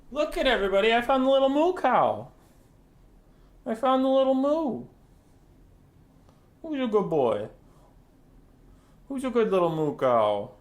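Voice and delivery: high, sing-song praise; high doting pet voice. Real, not acted. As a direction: show off the little pet proudly. Showing pet to viewers.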